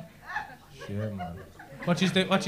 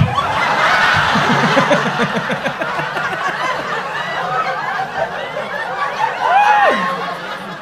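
long high cackling laugh at his own joke; long high cackle. Real, not acted.

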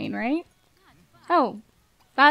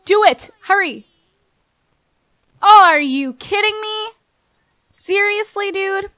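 loud and higher, urgent then disbelieving; excited yelling; 7 semitones higher, 6 dB louder than usual. Real, not acted. Player yelling at her sim to pet the deer, loud urgent shouting.

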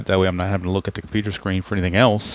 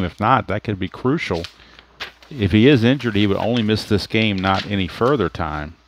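normal talking voice

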